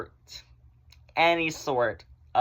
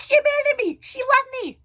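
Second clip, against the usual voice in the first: high squealing outcry of abandonment; mock wail; 5 dB louder than usual. Vlogger mimicking her younger self wailing at being left.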